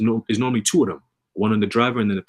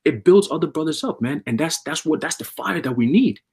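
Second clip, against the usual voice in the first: rising, louder preacher's fervor, building up; fiery preaching; 7 semitones higher, 3 dB louder than usual. Acted. Preacher firing up the brothers about coming spiritual power, higher and louder.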